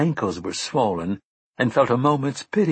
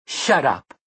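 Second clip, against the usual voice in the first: a touch of snapping anger; 4 semitones higher than usual. A narrator's reading. Mia snaps at Susanna.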